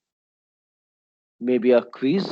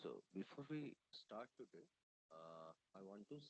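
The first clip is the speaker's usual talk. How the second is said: barely audible; 3 semitones lower, 29 dB quieter than usual. Real, not acted. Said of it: "Sir, your voice is very faint".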